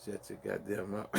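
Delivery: ordinary delivery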